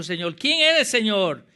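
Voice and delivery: loud, high-pitched dramatic question; preacher acting a stricken man's cry; Saul calling out. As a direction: cry out to the Lord, falling at the end. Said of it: "Y él dijo".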